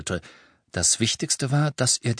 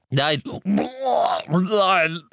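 high, strained, choking protest; retching refusal; 9 semitones higher than usual. A narrator's reading. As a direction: gag out a stubborn no.

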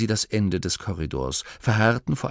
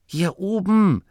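high, raised calling voice; von oben rufend; 11 semitones higher, 4 dB louder than usual. A narrator's reading. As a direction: call down to friends below. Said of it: "erklang Maskins Stimme". (hell von oben herabrufend)

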